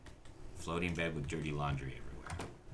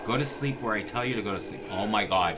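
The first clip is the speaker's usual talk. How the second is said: louder, higher scolding, losing patience; angry yelling; 4 semitones higher, 7 dB louder than usual. Real, not acted. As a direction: bark the orders at a Sim who won't sleep. Yelling at the Sim in game.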